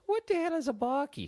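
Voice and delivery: bewildered Irish lad; puzzled outburst. A narrator's reading. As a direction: blurt out in confusion. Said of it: "Seamus wondered out loud".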